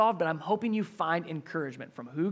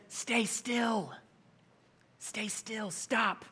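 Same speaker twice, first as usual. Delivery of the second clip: quiet command; 3 semitones higher, 8 dB quieter, much slower than usual. Acted. Moses calming panicked crowd.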